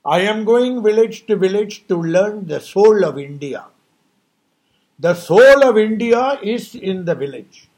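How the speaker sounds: voicing Gandhi. Quoting Gandhi's vow to walk village to village, proclaimed loudly. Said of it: "Look at what Mahatma Gandhi did".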